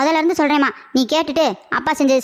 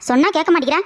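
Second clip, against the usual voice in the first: usual speaking voice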